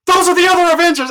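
high, loud, fast excited yell. Retold or imitated. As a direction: call it out over the crowd. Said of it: "and I'm just like".

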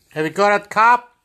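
loud, high call to a neighbour. Real, not acted. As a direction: call the question across the room. Calling out to neighbour.

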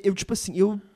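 normal talking voice

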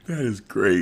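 ordinary talk, nothing marked

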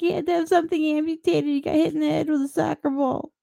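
high-pitched mock-fretful mom; overreacting mother. Mother fussing over a kid hit by a ball, mock alarm. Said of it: "you never know with some of those mothers".

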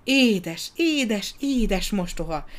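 put-on high, ringing child's exclamation; delighted high cry, easing on the last words; bell-like cry. A narrator's reading. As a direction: cry it out like a little bell, joyfully. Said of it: "kiáltotta csengettyű hangján".

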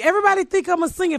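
ordinary talk

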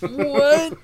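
very high shocked what. Real, not acted. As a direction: squeal it in disbelief. Reacts to stolen gift.

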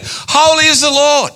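high, ringing cry of holy; exultant high acclamation; bursting praise. Retold or imitated. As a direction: let it spring out in rapture. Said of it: "it just springs forth going".

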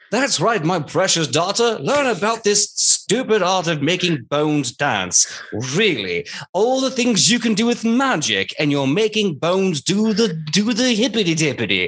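high, swooping voice of a scornful dad; disdainful father. Acted. Father belittling his daughter's necromancy class, disdainful. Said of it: "you hear him going".

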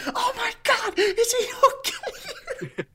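very high squeaky mom voice, laughing through it; hammed-up panicking mother on the phone, slow; imitating panicked mom. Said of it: "I just hear my mom like".

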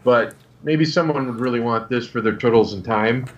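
normal talking voice

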